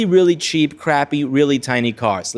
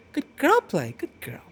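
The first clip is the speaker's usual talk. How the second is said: high cooing praise that fades into a whisper; cooing to cat; 5 semitones higher, 6 dB quieter than usual. Retold or imitated. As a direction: coo high to the kitty, then let it drop to a whisper. Dino cooing to his cat.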